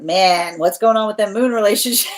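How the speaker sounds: high, loud, amused outburst; real laugh overtaking the words; breaking into laughter. Real, not acted. Reader reacting to the moon relationships card, breaking into laughter.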